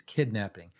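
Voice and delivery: ordinary talk, nothing marked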